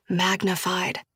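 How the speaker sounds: ordinary delivery, nothing marked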